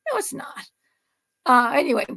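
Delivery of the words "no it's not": whispered, gentle denial; whispered reassurance. Real, not acted. Host to nervous cook: soft whispered reassurance. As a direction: breathe it softly with no voice.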